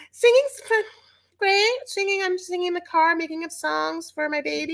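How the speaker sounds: very high-pitched mocking singer voice; Libby. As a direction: squeak out a put-on chirpy reply. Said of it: "she's like".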